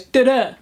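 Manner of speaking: "da-da" with weak first beat, strong second; stress pattern da-DA. Shown as a demonstration. Poet teaching students an iamb, stress on the second beat. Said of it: "An I am is an unstressed syllable followed by a stressed syllable".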